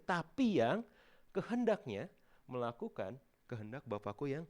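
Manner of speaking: loud, high proclaiming of the verse; preaching scripture. Acted. Preacher proclaims Jesus' words to the church, loud and high. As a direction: thunder the verse out to the congregation.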